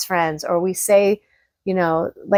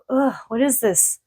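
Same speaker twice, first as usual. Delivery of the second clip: disgusted ugh; 5 semitones higher, 3 dB quieter than usual. Retold or imitated. Reacting to heavy energy.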